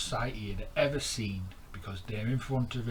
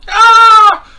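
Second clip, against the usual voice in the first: very high, very loud frightened shriek; shriek of fright; 29 dB louder than usual. Acted. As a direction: scream like a tiny fish facing a shark. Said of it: "shrieked Stanley".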